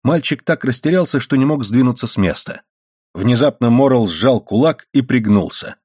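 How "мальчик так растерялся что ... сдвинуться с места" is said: ordinary delivery, nothing marked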